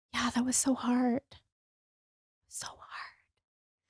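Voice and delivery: soft unvoiced whisper fading almost to nothing; barely breathed out, holding back tears; tearful whisper. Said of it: "I burst out crying on this journey".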